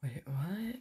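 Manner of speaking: breathless whisper of disbelief. Real, not acted. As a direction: hush it, barely voiced. Quiet confusion in horror game.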